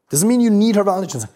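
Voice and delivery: normal talking voice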